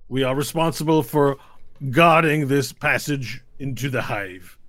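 formal knight captain. Acted. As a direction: declare your rank to the travellers. GM voices the captain.

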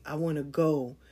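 ordinary talk

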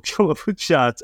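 usual speaking voice

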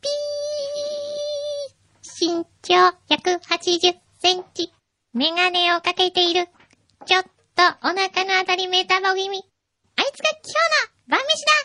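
high, cackling alien chanting warawara; alien monster voice. Acted. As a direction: play a hungry alien spotting prey. Alien monster hunting earthlings.

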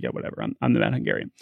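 a low, quiet, careless shrug of a voice; Mad Hungarian. Retold or imitated. Pitcher brushing off batters crowding the plate, low and quiet. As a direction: toss it off low and quiet like nothing matters.

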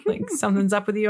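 soft, lightly voiced dog giving in; resigned dog voice. Dog gives up on going out, in a soft resigned voice. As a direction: back down quietly, as the dog. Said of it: "my dog was just like".

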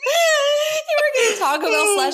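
high-pitched words squeezed through laughter; helpless laughter. Real, not acted. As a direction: barely get it out while cracking up. Cracking up at the running joke.

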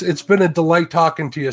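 normal talking voice

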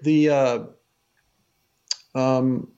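halting false starts with long pauses; stuttering and stammering. Real, not acted. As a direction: stumble over the start, searching for the words. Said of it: "I'm stuttering and stammering here".

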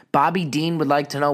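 usual speaking voice

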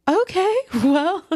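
high, slow, laughing okay well; shocked doctor. Retold or imitated. Doctor stunned by her popping hip, high and slow.

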